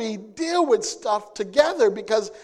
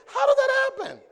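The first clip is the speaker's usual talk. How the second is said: puzzled; faster than usual. Acted. Mock puzzled husband question.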